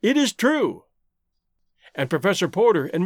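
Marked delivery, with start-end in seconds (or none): 0.0-0.8 higher-pitched, amazed exclamation; surprised exclamation. A narrator's reading. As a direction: exclaim it as a startling realization. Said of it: "he exclaimed".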